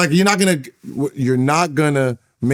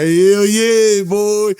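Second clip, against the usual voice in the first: loud, high mock whoop; imitated happy cheer; 8 semitones higher, 5 dB louder, much slower than usual. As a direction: act out a teammate's gleeful hollering. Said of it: "is sitting there happy".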